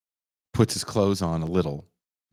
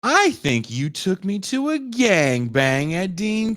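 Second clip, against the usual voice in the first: high, slow mock-shocked wife; 12 semitones higher, 4 dB louder, slower than usual. Wife realizes where her husband took her, mock-shocked and high. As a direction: spell out the accusation, stunned. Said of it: "She's like".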